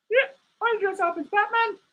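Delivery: high squeaky meerkat puppet; high puppet voice for Alan. Acted. Streamer voicing his meerkat puppet, high and cheeky.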